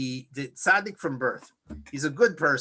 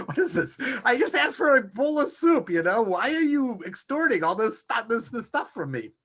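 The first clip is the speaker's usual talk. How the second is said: indignant Esav; 6 semitones higher than usual. Acted. Esav voiced, indignant over soup.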